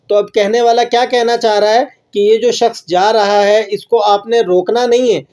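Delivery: ordinary talk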